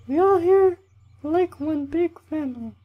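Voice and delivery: loud, squeaky falsetto camp leader giving a speech; hammed-up mock female falsetto; bad girl voice. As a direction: lecture in a badly faked, very high female voice. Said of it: "That was a really bad girl voice".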